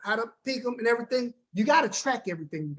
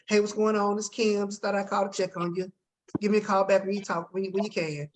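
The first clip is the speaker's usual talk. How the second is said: typical dull voicemail; 2 semitones lower than usual. Acted. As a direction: leave an unexcited, routine voicemail. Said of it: "this is what most people do".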